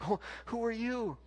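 high, soft bewildered voice; baffled question. Retold or imitated. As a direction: ask it as if caught off guard. Retold stranger at his door.

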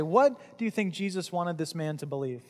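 usual speaking voice

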